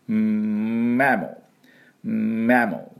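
mammal begun on a held, hummed "mmm"; lips firmly closed. Shown as a demonstration. Teacher drilling the M, hummed lead-in to mammal. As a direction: press lips shut for the M, then release mammal. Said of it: "唇しっかり閉じてね".

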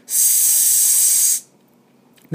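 very long voiceless S; sharp air-leak hiss. Shown as a demonstration. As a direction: leak air like a pinholed tire tube. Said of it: "空気が鋭く漏れる音". (空気が鋭く漏れる長いスーッ)

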